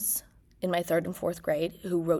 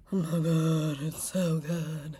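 while sipping a drink; slower than usual. Real, not acted. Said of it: "let me take a sip of mine".

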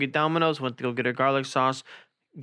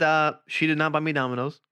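ordinary talk

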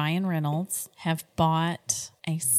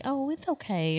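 put-on soothing the baby; 8 semitones higher than usual. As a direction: comfort the baby gently. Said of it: "I can be like".

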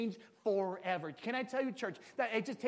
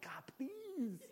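mock desperate prayer; 10 semitones higher, 10 dB louder, much slower than usual. Mock fervent altar prayer.

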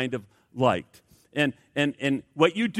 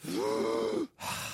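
deep breath gasp; 5 semitones lower, 9 dB quieter than usual. Shown as a demonstration. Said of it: "you just go".

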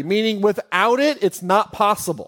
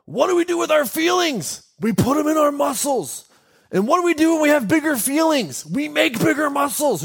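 higher, put-on voice quoting an Instagram meme; mock meme voice; 9 semitones higher than usual. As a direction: ham it up like a silly meme narrator. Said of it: "It's like a thing where it's like".

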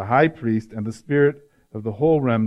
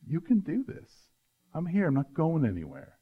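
softer dad comforting his crying son; gentle reassurance; 3 semitones higher, 7 dB quieter than usual. Retold or imitated. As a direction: soothe him quietly, close to his ear.

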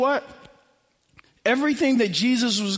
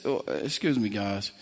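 much lower and softer, unbothered pastor; calm, polite excuse me; 13 semitones lower, 7 dB quieter than usual. Retold or imitated. Pastor politely cuts into the shouting crowd, low and soft. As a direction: quietly ease past them, unhurried. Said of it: "He says".

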